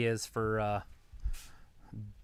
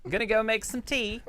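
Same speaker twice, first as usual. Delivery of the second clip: high-pitched, playful English voice; mock English accent; 10 semitones higher, 4 dB louder than usual. Joking about going off to make tea, mock English. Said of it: "do some English crap".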